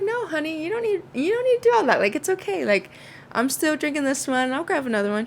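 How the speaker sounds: high, fast fussy no-honey protest; high polite protest. Retold or imitated. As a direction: wave her off sweetly. Protesting to hostess.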